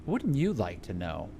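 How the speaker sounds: low, smug tease; low teasing creature. Acted. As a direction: tease them, deep and coy.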